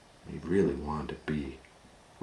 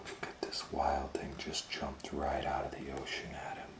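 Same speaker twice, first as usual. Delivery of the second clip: whispered aside; 12 dB quieter than usual. Real, not acted. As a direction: point at the picture in a whisper.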